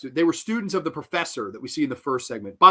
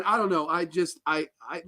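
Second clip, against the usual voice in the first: ordinary talk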